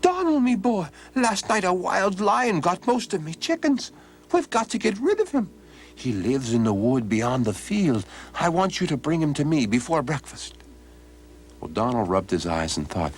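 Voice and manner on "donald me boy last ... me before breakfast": put-on high, pressing old man's voice; urgent old man. Old man urgently wakes Donald about the lion, high and pressing. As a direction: rouse him with urgency. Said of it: "speaking in an urgent voice".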